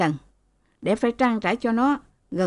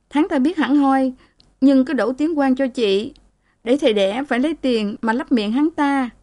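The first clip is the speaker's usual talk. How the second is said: higher-pitched voice of a woman confiding her troubles; aggrieved married sister; 6 semitones higher than usual. Acted. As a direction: pour out a grievance to your sister, pitched up.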